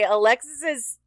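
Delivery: usual speaking voice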